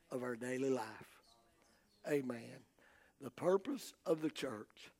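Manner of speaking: low, soft, measured closing appeal; solemn preaching. Real, not acted. Preacher warning folks to draw closer to the Lord, hushed and solemn. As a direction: bring it right down, phrase by phrase with pauses.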